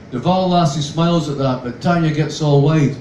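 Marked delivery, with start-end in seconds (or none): none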